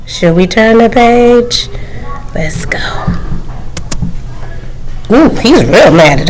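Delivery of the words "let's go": usual speaking voice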